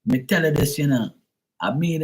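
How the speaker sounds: high-pitched mock Jamaican; Jamaican accent. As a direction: imitate a Jamaican artist being interviewed. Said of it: "And he says".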